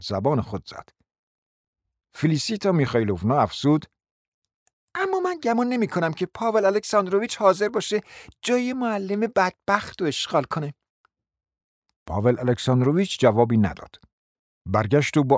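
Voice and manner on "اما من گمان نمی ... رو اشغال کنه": sly taunt. A narrator's reading. Felisita voiced, taunting.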